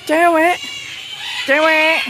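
very high, loud squawky call; parrot-prompting call. Pretend. Visitor coaxing a cockatoo to talk, high loud call.

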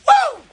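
high whooping cheer; excited woo. Real, not acted. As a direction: let out a loud celebratory whoop. Hyped celebration shout.

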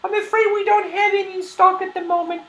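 high-pitched grandmother character voice; high old-lady shopkeeper; kindly old shopkeeper. Acted.

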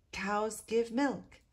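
slow sing-song repeat like a class; children echoing. Acted. Children repeating the teacher's words slowly together. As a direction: echo it back slowly as the whole class. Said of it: "echoed the children".

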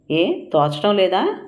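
ordinary delivery, nothing marked

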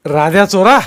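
loud, high bellow at a culprit; angry shout. A narrator's reading. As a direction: shout the accusation, pitched high. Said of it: "तो ओरडला".

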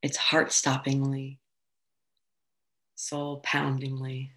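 calm, floating delivery with long pauses; guided meditation. Acted. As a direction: gently guide them through weightlessness, unhurried. Meditation settles into weightlessness.

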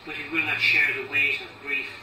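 deliberate recital, pressing on key words; poem reading. Acted. Poet reading about a father's grave, firm deliberate recital. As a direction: recite the verse firmly, pausing between phrases.

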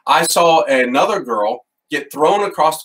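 normal talking voice

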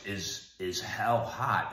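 slow, halting imitation of a grovelly voice. Dying father turns to his daughter, imitated in a grovelly voice. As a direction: imitate a weak man's grovelly question, slowly. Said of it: "in a real grovelly voice".

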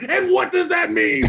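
high, loud mock-outraged shout; incredulous yell. [name] imitating Mike's outburst at five a.m., high disbelieving shout. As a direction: hurl the question back in disbelief. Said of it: "Mike was like".

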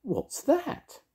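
very high fairy question; piping, puzzled little fairy. A narrator's reading. Fairy figure asks in story.

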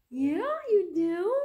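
high and loud, slow delighted coo; light up at her like she's the best. Real, not acted. Mother thrilled her baby wants sweet potatoes, high excited coo.

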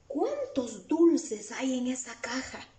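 slow, drawn-out amazed exclamation; wide-eyed wonder, slowly; exclamation of surprise. Shown as a demonstration. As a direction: read it as if astonished, stretching it out. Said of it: "¿Por qué leo así? Tiene signos de admiración".

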